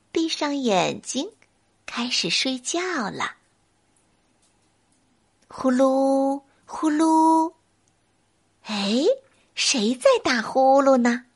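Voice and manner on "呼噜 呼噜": snore it gently; snoring. A narrator's reading. Imitated snoring.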